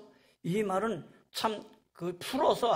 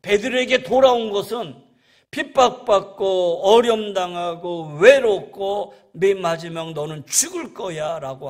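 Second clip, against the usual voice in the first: loud, raised preaching voice; forceful preaching; 5 semitones higher, 12 dB louder than usual. Real, not acted.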